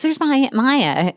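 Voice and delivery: put-on high, slow, happy child's cry; girl spots her aunt on TV; delighted child. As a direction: point at the screen like a thrilled kid. Daughter points at TV.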